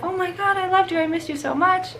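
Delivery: mock doting owner greeting the dog; gushing owner. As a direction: overdo the welcome home. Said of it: "They're like".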